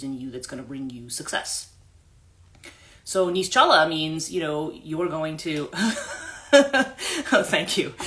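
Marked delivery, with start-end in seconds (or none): 5.7-7.9 laughing thanks, higher; bashful laughing thank you. Real, not acted. Laughing off the flattery, real laughter.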